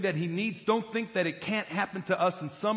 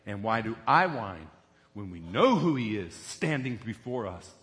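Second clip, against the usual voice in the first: ordinary talk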